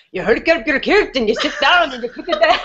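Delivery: hammed-up Scottish accent, laughing through it; mock Scottish brogue. Mocking an actor's put-on Scots to a friend, hammed-up Scottish accent. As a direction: lay on a fake thick Scottish brogue for a joke. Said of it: "He doesn't sound like that at all".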